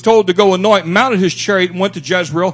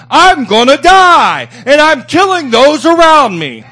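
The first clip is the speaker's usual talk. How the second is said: fervent preaching; 4 semitones higher than usual. Real, not acted. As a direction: hammer the warning home. Preaching climax.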